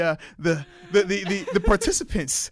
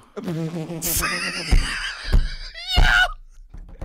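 shrieking laugh; 4 dB louder than usual. Real, not acted. Howling laugh at own joke.

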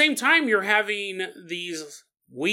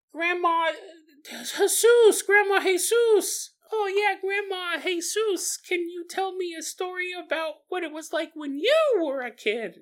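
little grandchild voice; 13 semitones higher than usual. Pretend. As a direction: ask sweetly like a small kid begging for a story. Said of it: "child asks grandma for story".

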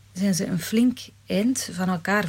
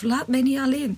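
desperate plea; 5 semitones higher than usual. A narrator's reading. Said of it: "gorgelt de vrouw".

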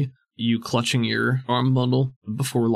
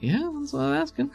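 character voice; 4 dB quieter than usual. Acted. GM repeats in character voice.

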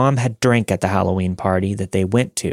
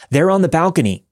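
high-pitched, raised-voice call of alarm; mock yell; 8 semitones higher, 4 dB louder than usual. A narrator's reading. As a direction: call it out like mom's yell, restrained. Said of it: "yelled out at the top of her lungs".

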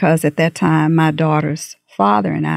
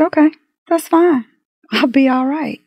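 much higher, bright show of defiance; defiant brush-off; 9 semitones higher than usual. Retold or imitated. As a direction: shrug off the threat, chin up. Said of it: "And I said".

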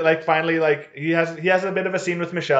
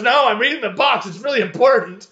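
mock insistence; 7 semitones higher, 5 dB louder than usual. Voicing Sam refusing to be interrupted.

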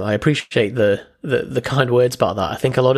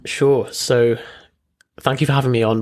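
normal talking voice

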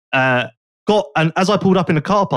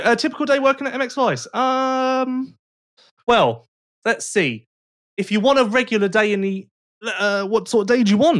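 usual speaking voice

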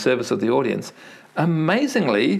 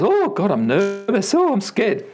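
mock nervous nurse; 7 semitones higher than usual. Patient retells the nurse fretting over her own nerves, mock scared. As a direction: play a jittery nurse, mock-panicked. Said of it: "this nurse goes".

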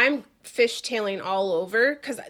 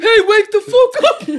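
much higher, louder, laughing yell; mock yell; 12 semitones higher, 13 dB louder than usual. Driver imitating the frantic wake-up that never came, mock yell. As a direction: show the alarmed way she did not say it. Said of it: "not like".

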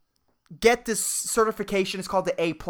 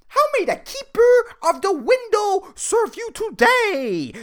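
much higher, slow, hammed-up cheerful server; mock grand service voice; 4 dB louder, slower than usual. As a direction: announce it like a pompous shop clerk. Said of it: "I'm supposed to act all happy".

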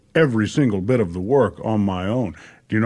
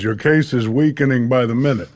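ordinary talk

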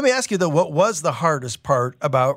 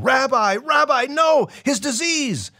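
alarmed calling out; 12 semitones higher than usual. Acted. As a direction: call him back in panic. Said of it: "The others reach out to Jesus, calling".

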